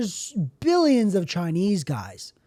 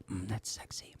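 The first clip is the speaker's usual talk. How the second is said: low, hushed, appreciative murmur; sultry whisper; 10 semitones lower, 17 dB quieter than usual. Retold or imitated. Reacting to Angel speaking Spanish to the server, sultry whisper. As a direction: whisper it like a private swoon.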